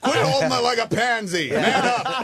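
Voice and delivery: high, loud mock command to a star; mock director order. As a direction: ham up a bossy director barking at the star. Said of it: "Yeah, I'm like".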